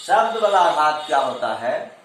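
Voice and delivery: usual speaking voice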